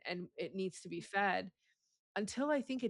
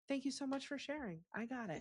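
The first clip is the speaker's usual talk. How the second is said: softer, higher, exaggeratedly polite thanks; gently hammed-up appreciation; mock gracious reply; 5 semitones higher, 8 dB quieter than usual. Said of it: "should be like".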